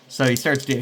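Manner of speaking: normal talking voice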